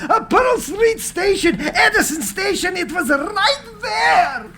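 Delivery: thrilled at the memory, half laughing; excited. Acted. Tesla sees his rival's station.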